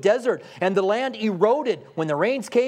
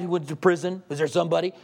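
ordinary delivery

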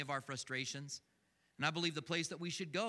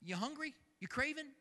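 much higher, coaxing mock question; teasing offer; 10 semitones higher than usual. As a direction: dangle it like a tempting treat. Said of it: "And when God says".

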